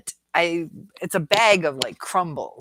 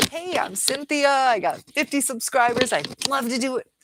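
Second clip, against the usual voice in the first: a lightly voiced, higher, chirpy newbie pitch; mock tiny creator; 8 semitones higher, 4 dB louder than usual. Creator mocking a small newcomer, in a higher, mock-eager voice. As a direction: play it up as the eager beginner with fifty subscribers.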